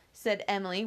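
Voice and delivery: ordinary delivery, nothing marked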